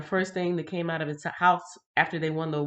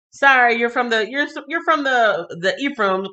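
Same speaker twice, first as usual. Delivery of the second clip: loud, higher-pitched joking call-out; mock accusation; 6 semitones higher, 9 dB louder than usual. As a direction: pretend to catch an Ephraimite out, loudly. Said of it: "Just kidding".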